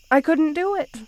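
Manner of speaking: a pained cry of admission; anguished outburst. Acted. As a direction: blurt out the confession. Confessing mercy to beloved.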